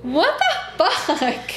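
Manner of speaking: slow, high, laughing outrage; laughing disbelief. Real, not acted.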